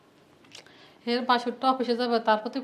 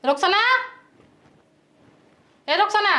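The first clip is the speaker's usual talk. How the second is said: name called out with raised pitch; calling out; 4 semitones higher than usual. Acted. Woman calling her sleeping sister-in-law, calling out impatiently. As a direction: summon someone from another room.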